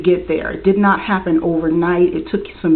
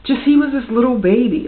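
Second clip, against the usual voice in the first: getting emotional; 5 semitones higher than usual. Real, not acted. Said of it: "get a little bit emotional and get teary-eyed".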